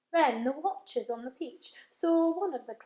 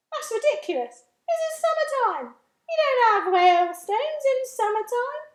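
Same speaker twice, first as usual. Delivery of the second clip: high, loud, mocking disbelief; scoffing centipede; 10 semitones higher, 8 dB louder than usual. Centipede to James, loud scoffing disbelief. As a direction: scoff at the idea. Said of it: "the centipede said".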